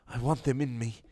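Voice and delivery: lightly voiced eager castle.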